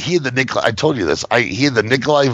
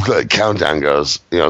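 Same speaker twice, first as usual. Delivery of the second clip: ordinary talk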